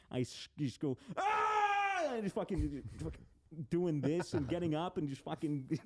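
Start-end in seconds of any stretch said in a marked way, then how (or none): 1.2-2.0 sudden high shrieking scream. Retold or imitated. Retelling the spider two inches from his face, screaming. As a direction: let out a startled shriek. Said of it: "I just fucking scream".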